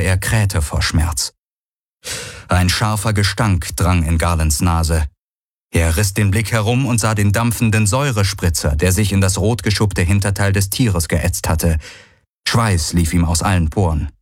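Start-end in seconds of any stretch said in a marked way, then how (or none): none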